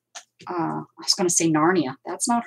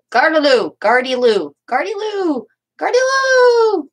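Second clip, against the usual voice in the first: loud, rising call, stretched higher each time; demonstrated French street yell; 8 semitones higher, 9 dB louder than usual. Shown as a demonstration. Crafter showing how the French used to yell a phrase, calling out. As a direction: holler it out, building to a long high cry. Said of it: "the French used to yell that phrase".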